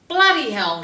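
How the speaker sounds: loud exasperated curse; grumpy outburst. Retold or imitated. Grumpy client cursing about jury duty, loud exasperated. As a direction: blurt it out fed up. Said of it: "she was very grumpy".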